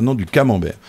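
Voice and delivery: corrective "camembert", first syllable "ca" accented; front-stressed "camembert" in a firm correction. Shown as a demonstration. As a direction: punch the "ca" of "camembert", then fall. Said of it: "on va répéter en accentuant le début".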